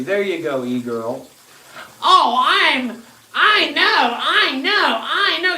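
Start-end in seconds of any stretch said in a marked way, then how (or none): 2.0-5.6 high, slow sing-song mockery; mocking a naive follower in a high whine; mocking whiny voice. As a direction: parrot the know-it-all in a high whine. Mocking listener's whiny agreement.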